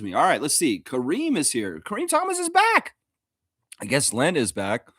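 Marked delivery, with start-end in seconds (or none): 1.8-2.9 excited announcement. Real, not acted. Announcing a returning chatter.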